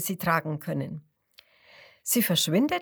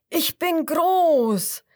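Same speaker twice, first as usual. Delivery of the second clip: much higher, loud, slow roar; crocodile roar; 9 semitones higher, 6 dB louder, much slower than usual. A narrator's reading. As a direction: stretch it out as a huge croc. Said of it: "Das zweite ruft". (lautes, hohes, langsames Brüllen)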